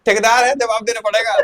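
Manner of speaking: hammed-up vendor telling them to just eat; loud laughing scold; mock scolding.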